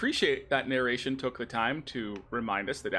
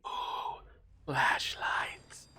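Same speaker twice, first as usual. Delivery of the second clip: breathy, whispered mock wonder; mock-awed whisper; much slower than usual. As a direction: whisper it in pretend awe. Mock awe at game's flashlights.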